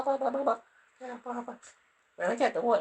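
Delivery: normal talking voice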